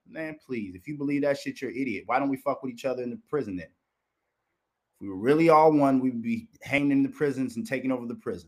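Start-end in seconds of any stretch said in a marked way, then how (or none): none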